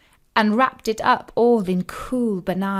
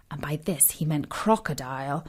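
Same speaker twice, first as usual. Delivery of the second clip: softer, hushed storyteller aside; whispered aside; 6 semitones lower, 8 dB quieter, faster than usual. A narrator's reading. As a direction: let the kids in on a secret.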